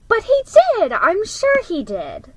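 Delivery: high, loud insistent protest. Acted. Molly insisting to Jill that Dad sent presents, high and loud. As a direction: cry out in defense of Dad.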